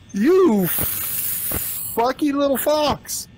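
drawn-out mocking insult; slow, sneering curse at the fox; slow angry taunt. Real, not acted. Cursing at animatronic fox.

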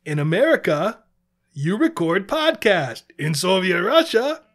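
put-on comic Russian accent with a yelled slow payoff. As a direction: Yakov Smirnoff style Russian reversal, punchlines belted out slow and loud. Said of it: "my Russian accent".

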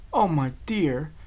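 slow, gentle comfort; tender reassurance; soothing. A narrator's reading. Rarity comforting.

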